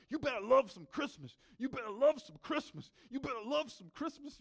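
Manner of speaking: high, loud mock-furious yelling; angry screaming. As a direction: imitate someone pumped up and screaming in anger. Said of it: "just screaming that".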